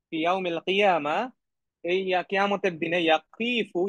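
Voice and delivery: ordinary talk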